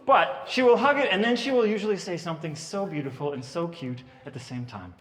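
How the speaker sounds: ordinary delivery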